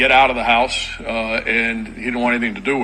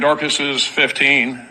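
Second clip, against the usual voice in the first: ordinary talk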